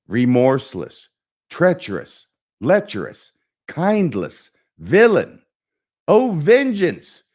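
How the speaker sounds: mounting high, loud stage fury; Hamlet. Acted. Hamlet raging at the villain for vengeance, building to a shout. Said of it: "try and just perform the soliloquy here".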